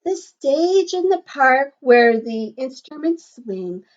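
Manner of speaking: lilting, sing-song picture book read; reading to children. Acted. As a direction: read the rhyme aloud to a class. Reading rhyme to class.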